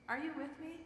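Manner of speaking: usual speaking voice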